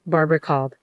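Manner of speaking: ordinary delivery, nothing marked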